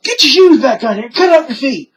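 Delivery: high, loud imitation of exasperated cops; mock shouted order. Cops telling mother about her boots, mock shouting. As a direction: raise the voice urgently, as retold.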